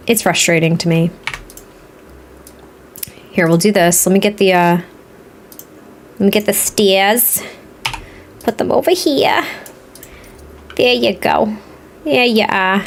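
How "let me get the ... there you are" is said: playful, exaggerated Boston accent. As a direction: slip into broad Boston, dropping the R's. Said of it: "I just went completely Boston on everybody".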